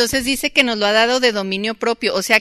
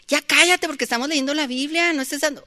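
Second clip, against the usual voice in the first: higher mock order to shut up; mother snapping; 7 semitones higher than usual. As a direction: hush the son sharply, playacted. Mother telling son to shut up.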